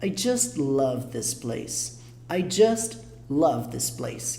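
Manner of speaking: slow, careful English demo; slow model reading. Shown as a demonstration. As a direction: spell it out slowly for the learner. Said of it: "essa frase em inglês é lida da seguinte forma".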